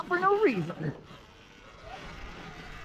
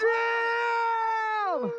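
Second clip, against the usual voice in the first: excited shout; 11 semitones higher than usual. Real, not acted. Reacting to a big jump.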